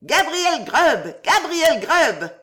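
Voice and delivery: voix aiguës et sauvages, lentes et hautes; chœur de lutins criant le nom, très aigu et lent; wild shrill chorus. A narrator's reading. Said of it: "répondirent en chœur des voix aiguës et sauvages". (chœur sauvage et aigu)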